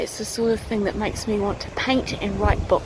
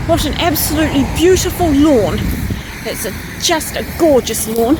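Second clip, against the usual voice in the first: high, loud burst of admiration; delighted exclamation; 6 semitones higher, 6 dB louder than usual. Real, not acted. Vlogger admires a garden lawn, delighted exclamation. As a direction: exclaim loudly in real delight.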